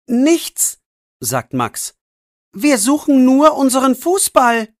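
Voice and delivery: a boy playing innocent; nervous boy. Boy excuses himself to policeman. (deutlich höhere, unschuldige Jungenstimme)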